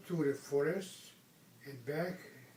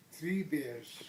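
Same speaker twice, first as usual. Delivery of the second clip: ordinary delivery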